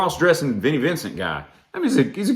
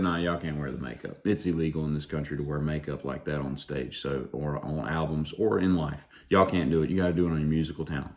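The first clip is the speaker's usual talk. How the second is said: low, quiet, quick rule-laying; mock decree; 8 semitones lower, 7 dB quieter, faster than usual. Imagined ban told to the makeup band, low quick decree. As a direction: lay down the rule low and fast. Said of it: "gone back in history and said".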